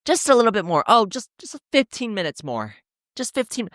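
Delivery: lightly voiced passing hiker brushing it off; mock hiker reassurance. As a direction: imitate a hiker fibbing lightly. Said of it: "They're always gonna say".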